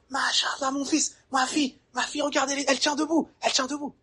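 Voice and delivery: put-on high, delighted praise for a baby's first stand; bursting with pride, pitched high; proud excited parent. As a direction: gush over the child.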